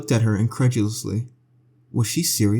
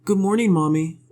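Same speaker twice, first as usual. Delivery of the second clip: put-on bright, higher child's greeting; cheerful little girl; 6 semitones higher than usual. A narrator's reading. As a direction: chirp it like a happy kid. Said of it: "Chrissy said cheerfully".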